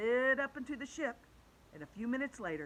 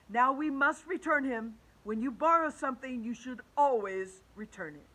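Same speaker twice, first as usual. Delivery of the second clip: loud, higher-pitched principal's address; 5 semitones higher, 8 dB louder than usual. A narrator's reading. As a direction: explain firmly as a school principal.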